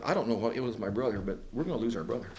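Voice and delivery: quick, low, soft grumble; worried grumbling. Acted. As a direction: grumble fast under your breath like a worried brother. Brothers fretting as David walks out.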